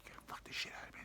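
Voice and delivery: hushed, whispered mock seduction; barely audible put-on whisper; whispered dirty talk.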